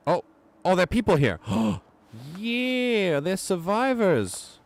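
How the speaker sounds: high, loud astonished cry; surprised shout. Real, not acted. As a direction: gasp out the discovery. Surprise finding survivors.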